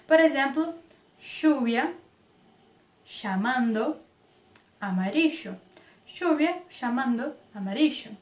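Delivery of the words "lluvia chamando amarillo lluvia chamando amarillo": lluvia and amarillo with ll as sh, rioplatense; sh for ll, Argentine style. Shown as a demonstration. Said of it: "o som de X, do nosso X". (pronúncia rioplatense com x)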